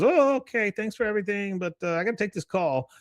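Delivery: high-pitched character excusing himself; hammed-up high voice, getting away politely; polite brush-off. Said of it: "He goes".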